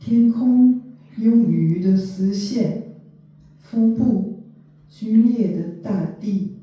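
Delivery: measured poetry reading, spaced line by line; poem recitation. Acted. Translator reading short poems to listeners, poem recitation. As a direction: recite it with deliberate pauses between phrases. Reads poem in two languages.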